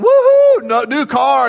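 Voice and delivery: very high, loud whoop of glee; excited cheer. Retold or imitated. Spouse celebrates a windfall with a high, loud whoop. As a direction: cheer like you just won money. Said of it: "one side's going".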